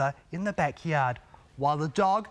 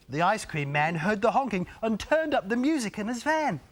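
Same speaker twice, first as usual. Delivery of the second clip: plain reading voice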